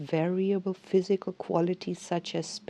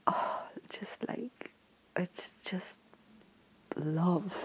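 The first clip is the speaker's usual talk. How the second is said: awed whisper; 8 dB quieter, slower than usual. Real, not acted. Overwhelmed with love for system.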